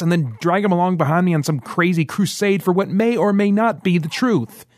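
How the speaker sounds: agitated rant. Acted. Merrick ranting at his uninvited guests, rising high and agitated. Merrick rants, pacing the room.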